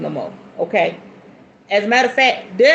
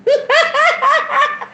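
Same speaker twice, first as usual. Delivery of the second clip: high-pitched giggling laugh; giggle. Real, not acted. Tickled by her man's compliment, high giggle.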